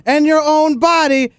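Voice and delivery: exasperated. Real, not acted. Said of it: "just keep going".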